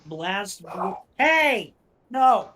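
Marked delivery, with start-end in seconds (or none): 1.2-2.5 loud sharp yell at dogs; shouting. Real, not acted.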